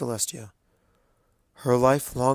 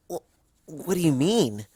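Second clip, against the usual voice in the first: put-on higher young pony voice, stammering in confusion; a young student caught off guard; Twilight, confused; 5 semitones higher than usual. A narrator's reading.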